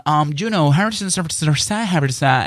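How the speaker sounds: high, mocking Spanglish with English words. Mocking Spanglish podcasters to his audience, high parody voice. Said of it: "con un spanglish malísimo".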